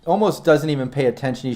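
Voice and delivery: ordinary delivery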